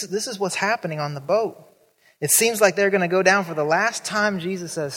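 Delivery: ordinary delivery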